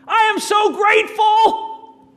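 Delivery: huge, high, slow shout of gratitude out the car window; screamed out the window. Retold or imitated. Shouting out the car window to the staff member, a huge joyful scream. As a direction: belt it out the window with all your joy. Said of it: "And I just screamed out the car".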